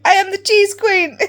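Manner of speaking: loud and high, crowning yourself cheese queen; proud proclamation. Retold or imitated. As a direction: shout it like a royal decree. Proud declaration retold to a friend.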